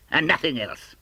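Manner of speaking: laughing with relief; laughing at the empty room; triumphant chuckle. Acted. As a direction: chuckle through it, pleased. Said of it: "said Scrooge triumphantly".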